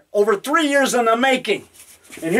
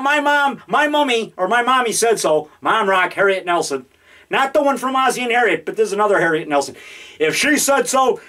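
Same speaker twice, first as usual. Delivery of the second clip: normal talking voice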